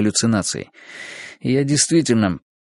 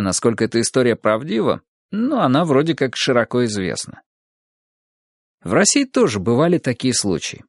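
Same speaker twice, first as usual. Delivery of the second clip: plain reading voice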